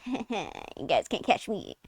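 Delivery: slow, soft sing-song taunt; sly taunt. Retold or imitated. Kid taunting teachers, soft and slow. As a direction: tease them under your breath, slowly.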